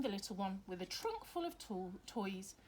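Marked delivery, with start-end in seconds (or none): none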